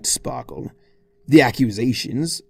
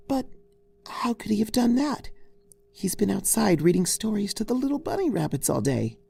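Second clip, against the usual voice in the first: much higher, soft dismay; worried Fluttershy; 9 semitones higher, 4 dB quieter than usual. Acted. Fluttershy hearing Twilight's complaint, soft dismay. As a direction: gently fret.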